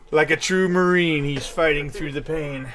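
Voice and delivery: loud dramatic narrator voice; booming epic trailer voice; heroic announcer. Acted. GM hypes player's heroic struggle.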